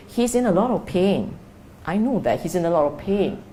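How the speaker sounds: soft, gentle and understanding; wise, compassionate way. Shown as a demonstration. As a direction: speak quietly with compassion for him. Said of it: "you wanna talk about him, you say".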